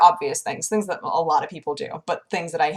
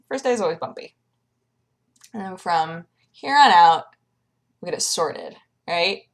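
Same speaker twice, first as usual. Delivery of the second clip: usual speaking voice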